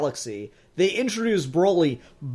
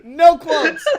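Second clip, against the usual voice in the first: high laughing scold, loud on the second no; laughing protest; 10 semitones higher, 8 dB louder than usual. Real, not acted. Host laughing at cohost who broke the no-quotes rule, high laughing protest. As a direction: scold your friend while cracking up.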